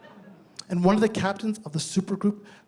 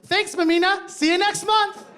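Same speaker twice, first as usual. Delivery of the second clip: high cheerful thanks; cheery bar crowd; 11 semitones higher than usual. Retold or imitated. Bar crowd grabbing samosas, high cheerful thanks. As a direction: chirp it like happy regulars, much higher. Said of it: "grab one and go".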